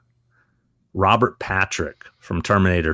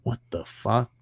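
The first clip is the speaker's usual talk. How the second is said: soft, under-the-breath disbelief; muttered bafflement; 2 semitones higher, 8 dB quieter than usual. Shown as a demonstration. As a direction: show an actor a quiet puzzled aside. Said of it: "just kinda go".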